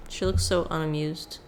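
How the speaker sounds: normal talking voice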